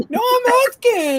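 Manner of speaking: slow squeaky falsetto, mocking; high-pitched imitation. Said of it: "that high pitch".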